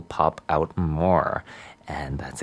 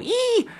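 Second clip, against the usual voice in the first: high-pitched delighted "eee"; excited squeal. Retold or imitated. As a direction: let out a short, high squeal. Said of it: "really made me go".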